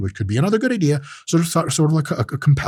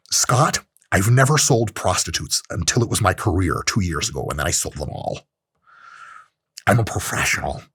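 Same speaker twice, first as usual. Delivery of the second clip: Andrew Tate impression; 6 semitones lower, 3 dB quieter than usual. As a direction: play a smug, self-important alpha. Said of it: "Here's my shit Andrew Tate impression".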